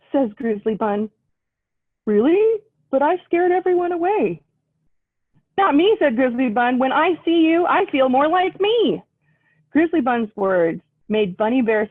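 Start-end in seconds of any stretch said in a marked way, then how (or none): 2.1-4.4 higher, doubtful little voice; unsure, a bit sad; Bunny Bear. Acted. Bunny Bear doubting himself to Grizzly Bun, higher. 5.6-9.0 higher, sincere little bunny; Grizzly Bun. Acted. Grizzly Bun comforting Bunny Bear, higher and sincere.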